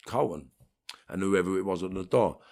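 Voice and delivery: ordinary talk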